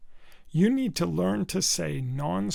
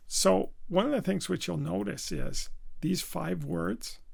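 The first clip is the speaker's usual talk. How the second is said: normal talking voice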